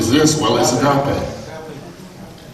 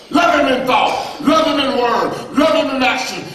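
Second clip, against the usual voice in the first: full-force preaching; 13 semitones higher than usual. Acted. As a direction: lift the congregation, preaching hard.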